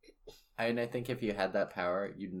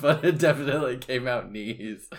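laughing; 7 semitones higher, 9 dB louder than usual. Real, not acted. Laughing over her slip.

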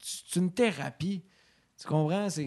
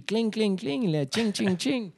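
higher, playful cha-ching sounds; cash register noise; 6 semitones higher, slower than usual. Retold or imitated. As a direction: ring it out like a till.

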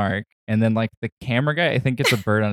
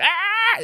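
high squawking bird shriek; imitated bird scream. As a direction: shriek like a panicked cockatiel. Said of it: "It just starts screaming".

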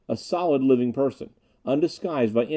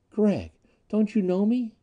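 high-pitched, imploring question; pleading; 10 semitones higher than usual. A narrator's reading. Anita begs Gregg to recognize her, pleading. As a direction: implore him gently, pitch lifted. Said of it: "A soft pleading".

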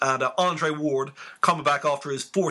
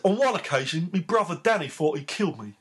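lightly put-on Cockney South London accent, higher; 5 semitones higher than usual. Reading Nigel Benn's autobiography in a light Cockney accent. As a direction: read it in a hint of Cockney, laughing along. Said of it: "my Cockney South London accent".

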